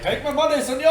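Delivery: high-pitched mock begging to buy; eager customer. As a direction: shout it like a desperate buyer, playfully. Acted eager customer running to van.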